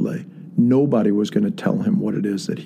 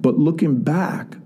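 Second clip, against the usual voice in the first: ordinary delivery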